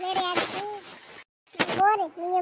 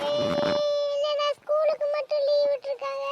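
younger sister; 8 semitones higher, 4 dB louder, slower than usual. Acted. Younger sister voiced by same actor.

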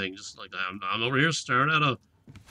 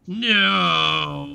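loud, drawn-out wail of protest; frustrated cry; 5 semitones higher, 8 dB louder than usual. Real, not acted. Player thwarted by a locked door, frustrated cry. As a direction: cry out in dismay, higher and louder.

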